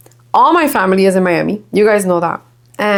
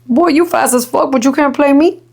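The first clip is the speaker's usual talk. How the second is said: higher, laughing taunt; voicing the dog; 6 semitones higher than usual. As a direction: tease the puppy as the big dog. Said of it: "And Callie's like".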